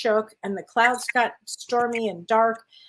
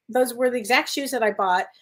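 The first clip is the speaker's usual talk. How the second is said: ordinary delivery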